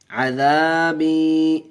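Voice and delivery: عذابی with the long a and long i each held a full beat; عذابی sung slowly, both long vowels held; vowel drawn out. Shown as a demonstration. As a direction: stretch the madd vowels in عذابی. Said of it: "ایک الف کھینچ کے پڑھیں گے".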